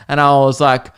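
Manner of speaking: ordinary talk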